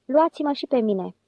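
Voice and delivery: plain reading voice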